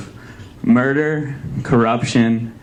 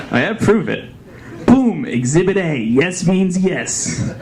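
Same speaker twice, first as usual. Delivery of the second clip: bro voice; 6 semitones higher, slower than usual. Pretend.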